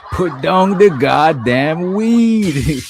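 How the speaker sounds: words stretched out through laughter; laughing. Real, not acted. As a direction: laugh it out slowly. Laughing at friend mid-joke.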